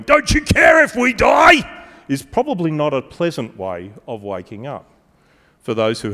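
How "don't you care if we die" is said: high, loud frantic yell; panicked shout. Retold or imitated. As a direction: shake him awake screaming in panic. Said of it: "someone shaking me going".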